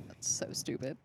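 soft whispered aside; embarrassed whisper. Real, not acted. Mocking her own mall memory, a soft whispered aside.

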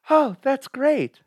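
gasping, high, slow wife voice; gasp, then gush slowly in a high wife voice. Acted. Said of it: "I'll even do voices".

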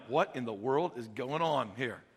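low, soft bewildered voice; a confused onlooker muttering; puzzled driver. Retold or imitated. Chariot driver baffled by the baptism, low and soft.

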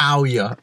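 "How are ya" as a single run-together word, no h; run into one word. Shown as a demonstration. Repeating the Leicester greeting for a friend, run into one word. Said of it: "Like, one word".

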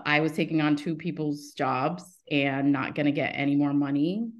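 ordinary talk, nothing marked

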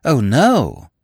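obligingly emphatic "no"; emphasis on "no". A narrator's reading. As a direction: put real weight on the last word, "no". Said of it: "Much better".

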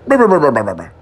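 buzzing noise. Pretend. Mimicking a buzzing zap.

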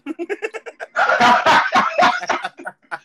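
high shrieking laugh fit; loud high laugh. Real, not acted. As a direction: crack up laughing. Laughing at own story.

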